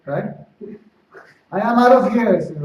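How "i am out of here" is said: loud, high-pitched outburst; retold angry exit. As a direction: act out someone storming off.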